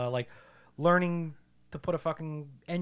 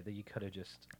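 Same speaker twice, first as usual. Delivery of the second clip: whispered softly; 6 semitones lower, 20 dB quieter than usual. Real, not acted. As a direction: drop to a whisper. Unvoiced aside.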